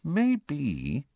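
high, musing "maybe"; tentative thinking aloud, lifting at the end; thoughtful. A narrator's reading. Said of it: "said Hermione thoughtfully".

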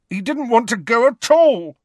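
loud, high angry outburst; angrily. A narrator's reading. Harry rejecting Hagrid's words about Sirius, angry and loud. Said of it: "said Harry angrily".

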